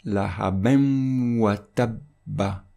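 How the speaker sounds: slow "lahabin watabba", the n melted into a long nasal w; linked recitation, nasal glide held between "lahabin" and "watabba"; nasal assimilation. Shown as a demonstration. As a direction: hum from the end of "lahabin" into "watabba". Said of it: "Et on va le prononcer".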